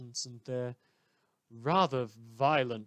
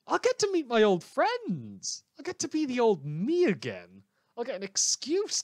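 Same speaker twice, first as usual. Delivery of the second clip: manic glee; 9 semitones higher, 6 dB louder than usual. Acted. Radio host's manic outburst.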